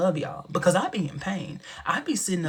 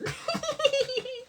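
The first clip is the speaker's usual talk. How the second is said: very high laugh, softer; troll laugh; 4 dB quieter than usual. Real, not acted. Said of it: "I kinda laugh like a giant troll".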